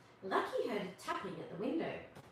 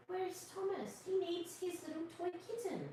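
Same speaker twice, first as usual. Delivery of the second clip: higher and softer, anxious; soft, higher anxious question; worried voice; 8 semitones higher, 5 dB quieter than usual. A narrator's reading. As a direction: fret about the missing boy. Worried character asks.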